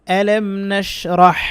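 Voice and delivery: ইহসানা drawn out very slowly with a throat হ; Quran word recitation. Shown as a demonstration. Teacher demonstrating Quran words for students, slow recitation. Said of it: "উচ্চারণটা শিখেন".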